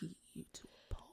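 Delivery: playful threatening whisper. Pretend.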